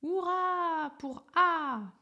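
high, loud, drawn-out hurrah; joyful children's hurrah, high and loud; cheering shout. Acted. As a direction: cheer it slowly like a crowd of kids. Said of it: "Les enfants hurlèrent".

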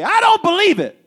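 high-pitched mock outburst of disbelief; exclaiming wife, imitated. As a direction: cry out like an incredulous wife. Acts out wife's loud disbelief.

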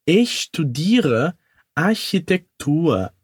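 slow, careful German answer; slow demo answer; slow model line. Shown as a demonstration. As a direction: say it slowly for learners. Teacher models German line slowly.

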